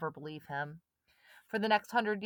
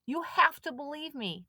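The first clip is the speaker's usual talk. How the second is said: higher, pleading insistence; boy begging friends to listen; insisting; 9 semitones higher, 3 dB louder than usual. A narrator's reading. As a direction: make them believe you. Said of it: "Chet insisted".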